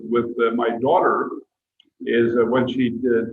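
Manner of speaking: ordinary talk, nothing marked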